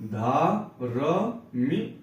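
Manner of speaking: धार्मिक stretched as धा-र-मिक, very slow; teacher sounding out धार्मिक slowly; slow syllable reading. Shown as a demonstration. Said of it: "जब भी ये लगा रहे".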